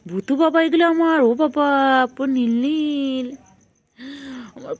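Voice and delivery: high, loud cooing gush. Real, not acted. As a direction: gush over the pet, stretching the last words. Doting baby talk to pet. (আহ্লাদী উঁচু গলা)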